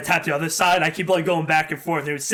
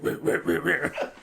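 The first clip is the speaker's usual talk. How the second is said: mock dog growl. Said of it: "He'd be like".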